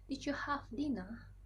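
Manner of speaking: slow British English accent, dinna without the r. Shown as a demonstration. A student demos how a Brit asks about dinner, slow British accent. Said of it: "orang Inggris akan berkata seperti ini".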